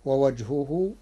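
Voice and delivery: normal talking voice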